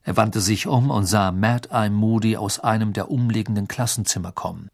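usual speaking voice

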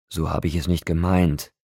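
ordinary delivery

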